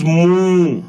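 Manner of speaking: a drawn-out, high "moo" like a cow; mock cow moo. Shown as a demonstration. Teacher imitating a cow's moo for the children. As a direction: moo it out slow for the kids. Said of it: "काऊ की आवाज".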